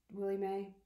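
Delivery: usual speaking voice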